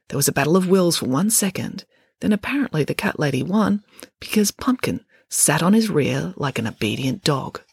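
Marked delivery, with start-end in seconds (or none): none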